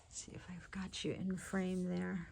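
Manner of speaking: soft unvoiced whisper to herself; whispered aside. Real, not acted. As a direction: mutter it under your breath while fixing the camera. Checking camera framing, aside.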